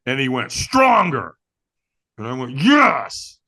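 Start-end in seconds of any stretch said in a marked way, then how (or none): 0.5-1.3 loud, raised-pitch coaching; urging command. Retold or imitated. Trainer demanding more from firewalker, urging command. 2.6-3.4 loud, raised-pitch affirmation; stronger yes. Retold or imitated. Firewalker answering trainer's demand, forceful.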